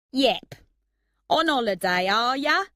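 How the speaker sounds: loud, chummy put-on Cockney; man-of-the-people Cockney, swooping up on the question; pretend Cockney accent. A narrator's reading. TV presenter chats to a fan, pretend Cockney accent. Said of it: "in his pretend Cockney accent".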